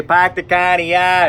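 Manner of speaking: mock Boston accent, r's dropped; put-on broad Boston vowels; Bostonian accent. Shown as a demonstration. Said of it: "doing some Bostonian accents real quick".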